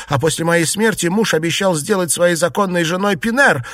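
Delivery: high, hurried agitated account; upset wife's breathless-paced tale; agitated retelling. A narrator's reading. Frightened wife tells her story.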